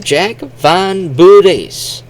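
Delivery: "Bures" stretched out, high and loud; very slow "Jack Van Bures", vowel slipped in after B; Japanese-style pronunciation. Shown as a demonstration. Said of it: "how the Japanese would say".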